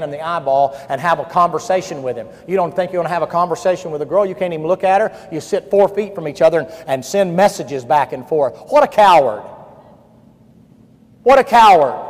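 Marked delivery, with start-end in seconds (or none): 2.5-9.4 loud, high, scolding preacher; hammering pulpit rebuke; full-force preaching. Acted. 11.2-12.0 higher, louder "What a coward" after a pause; scornful "What a coward", pitched up and falling; louder rebuke. Acted.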